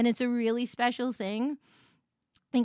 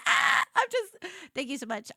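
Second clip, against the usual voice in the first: ordinary talk, nothing marked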